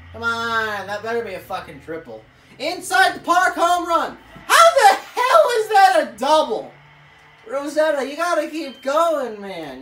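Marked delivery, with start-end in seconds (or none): 2.6-6.7 high, loud indignant yell; outraged shout. Real, not acted. Gamer arguing with the game's call, loud outraged shout. 7.5-9.4 louder pleading call to the runner; urging. Real, not acted.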